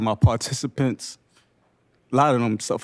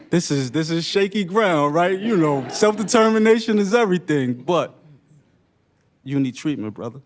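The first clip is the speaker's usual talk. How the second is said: higher, louder and slowing down, pleading hard; urgent plea; 6 semitones higher, 3 dB louder than usual. Retold or imitated. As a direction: press the brother earnestly, rising up. Urgently pleading with participant's brother.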